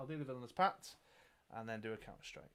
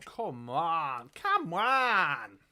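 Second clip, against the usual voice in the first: drawn-out wail, climbing higher and louder; building mock-desperate cry; exasperated plea; 4 semitones higher, 8 dB louder, much slower than usual. Real, not acted. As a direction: plead loudly with the dice, stretching the words. Pleading with the dice.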